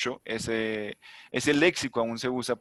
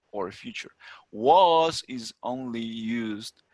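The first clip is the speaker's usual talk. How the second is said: slow English reading; 3 dB louder, much slower than usual. Acted. Reads rule text in English.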